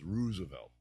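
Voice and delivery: Roosevelt said as ROOZ-uh-velt; "Roosevelt" starting like ruse; OO in first syllable. Shown as a demonstration. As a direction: stretch the two O's of Roosevelt into OO. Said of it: "Two O's".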